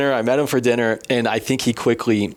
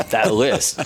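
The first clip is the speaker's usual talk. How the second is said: laughing question; 11 semitones higher than usual. Real, not acted. Asking about the Fortune list.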